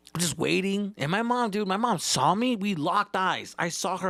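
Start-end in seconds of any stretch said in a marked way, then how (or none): none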